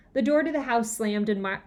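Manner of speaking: ordinary delivery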